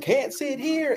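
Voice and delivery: high-pitched mocking "can't sit here"; mimicked movie refusal. Storyteller mocks the bus seat snub from Forrest Gump, high mimicry. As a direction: pitch it high, as if turning someone away. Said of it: "like I was Forrest Gump".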